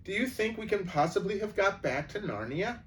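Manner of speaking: hardly daring to hope; excited question; eager exclaim. Acted. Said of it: "exclaimed Lucy".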